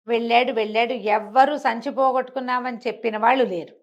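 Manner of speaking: ordinary delivery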